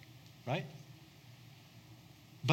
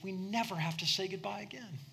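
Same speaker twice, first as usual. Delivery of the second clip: ordinary delivery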